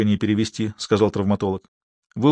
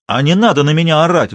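loud outburst; doctor snaps back at the shouting boss; exploding; 4 semitones higher, 5 dB louder than usual. A narrator's reading. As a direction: blow up at the boss. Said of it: "взорвался я".

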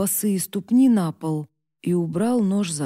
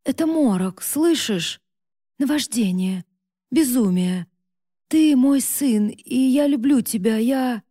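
desperate pleading; 5 semitones higher, 4 dB louder than usual. Acted. Mother begs son for mercy.

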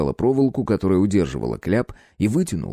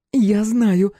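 smug; 12 semitones higher, 4 dB louder than usual. A narrator's reading. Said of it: "самодовольно ухмыльнулась она".

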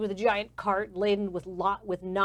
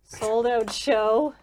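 loud, higher rush, slowing at the end; harried manager; 5 semitones higher, 8 dB louder than usual. Acted. Manager with acrobats out sick, harried. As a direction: cut them off and vent. Stressed manager brushes off visitors.

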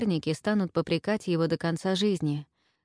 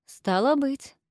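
measured, dignified reply; 8 semitones higher, slower than usual. Acted. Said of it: "с достоинством ответил протовестиарий".

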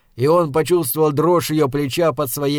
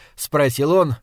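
plain reading voice

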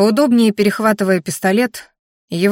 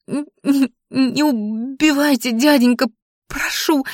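high, stumbling, frightened begging; stammering plea; 6 semitones higher than usual. A narrator's reading. Girl begs the armed man not to shoot, stammering. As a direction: stutter out a terrified plea. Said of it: "Запинающимся языком попросила она".

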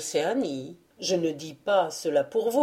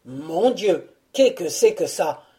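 louder, pained mock roar; hammed-up roaring cry; lion's roar; 5 dB louder than usual. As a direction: bellow it like a lion in pain. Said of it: "ce fut un rugissement".

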